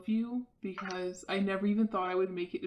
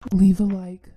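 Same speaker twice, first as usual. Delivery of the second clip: sudden shout; 15 dB louder than usual. Acted. Sudden outro call to viewers.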